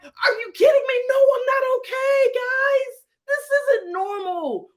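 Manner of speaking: high, loud, near-tearful outcry; panicked yell. Retold or imitated. To coworkers mid panic attack, high loud breaking outcry. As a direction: yell it like the walls are closing in.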